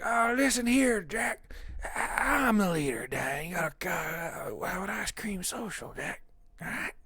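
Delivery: put-on high, soft, slow old-man ramble; Joe Biden impression. Streamer showing off his president impression, high slow ramble. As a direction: amble along like a doddering old politician. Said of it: "I do a good Joe Biden".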